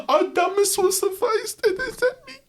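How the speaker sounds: high, cackling villain voice; anime villain. Acted. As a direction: gloat like a cartoon villain. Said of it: "You sound like an anime villain".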